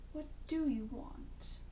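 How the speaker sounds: a hint of Ducky, slow and hushed; imitating Ducky. Drunk father scene, Ducky's line copied softly and slowly. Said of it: "I can't do it justice".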